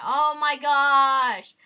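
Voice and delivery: loud, drawn-out outcry; exasperated yell. Real, not acted. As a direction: stretch it out in loud frustration. Frustrated outburst during game.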